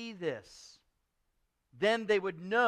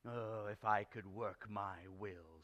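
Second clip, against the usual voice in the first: slow, low, brooding grumble; understated seething, kept soft; indignant; 8 semitones lower, 10 dB quieter than usual. Acted. Uncle wishes ill on Christmas well-wishers, restrained indignation. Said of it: "said Scrooge indignantly".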